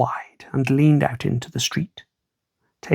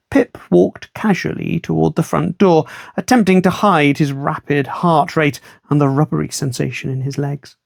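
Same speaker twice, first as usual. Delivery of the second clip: ordinary delivery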